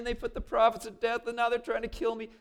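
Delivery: high, loud whining Elijah; whiny complaint. Retold or imitated. Elijah complaining to God, a high whiny voice. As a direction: whine it out like a sulking prophet. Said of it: "He was complaining".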